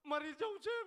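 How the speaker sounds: high, loud melodramatic wail; mock crying. Weeping woman tells the man her despair, mock crying. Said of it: "બેન રડતા હતા કે મરી જઈશ".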